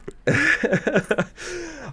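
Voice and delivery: a high, bright burst of laughter; laughing as the guest agrees to commentaries. Real, not acted.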